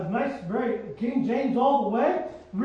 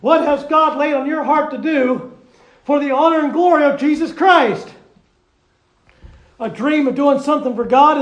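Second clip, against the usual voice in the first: loud, rousing pulpit preaching; preaching at full force; 2 semitones higher, 10 dB louder than usual. Acted. Preacher rallying the church behind Sister Barbara, loud and fervent. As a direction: whip the congregation up with every phrase.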